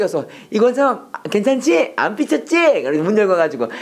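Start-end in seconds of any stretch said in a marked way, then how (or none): 0.5-2.8 high, slow placating questions; smooth it over with drawn-out, higher-pitched coaxing; coaxing boss. Acted. Boss checking the manager isn't sulking next morning, high coaxing.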